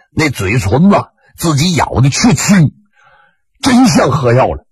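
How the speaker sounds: ordinary delivery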